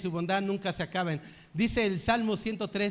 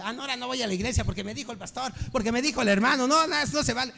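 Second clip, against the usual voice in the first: imitated sulky churchgoer's tantrum; 4 semitones higher, much faster than usual. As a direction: throw a childish fit, rushing and rising. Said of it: "Y hacemos berrinche".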